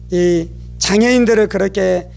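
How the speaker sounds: ordinary talk, nothing marked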